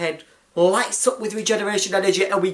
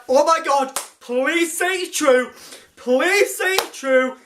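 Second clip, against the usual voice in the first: excited plea; 7 semitones higher, slower than usual. Retold or imitated. Fan pleading as he watched.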